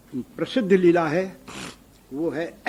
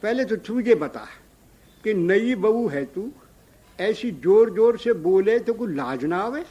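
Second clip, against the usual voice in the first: scolding; 4 semitones higher, 6 dB louder than usual. Acted. Yashoda scolds new bride.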